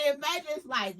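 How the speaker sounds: usual speaking voice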